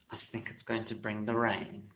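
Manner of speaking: soft whisper; hushed whisper to the painting. Real, not acted. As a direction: breathe it out as a secret. Hushed remark while painting serpent.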